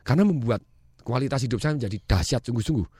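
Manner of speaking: ordinary talk, nothing marked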